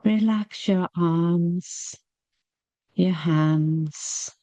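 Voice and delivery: ordinary talk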